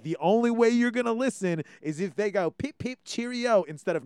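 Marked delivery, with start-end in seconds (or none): 2.5-3.6 high, chirpy mock-British; higher-pitched put-on British voice; British accent. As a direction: pipe up in a cartoon British accent. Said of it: "or they put on a British voice".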